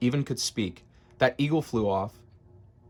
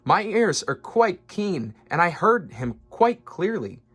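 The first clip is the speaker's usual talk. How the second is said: earnest elephant; 8 semitones higher, 4 dB louder than usual. A narrator's reading. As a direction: swear to it, eyes wide. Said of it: "said Horton".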